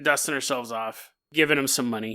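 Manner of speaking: ordinary talk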